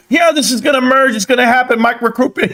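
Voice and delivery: high, eager mock of a hype man; mocking a hyped promoter. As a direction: parody the clueless hype guy in a high voice.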